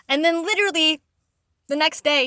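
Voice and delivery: ordinary talk, nothing marked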